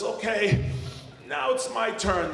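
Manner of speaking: high voice still hurting; strained determination. Acted. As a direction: announce your revenge through acted pain. Kicked man claims his turn.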